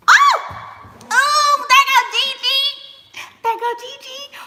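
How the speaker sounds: very high, loud shriek of recognition; excited squeal. Real, not acted. Streamer spots Gigi in the chat, excited squeal. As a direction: squeal as a favorite friend turns up.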